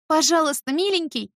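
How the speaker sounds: high, loud desperate plea; ringing plea. Acted. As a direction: cry out begging, voice ringing. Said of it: "моему звенящему голосу".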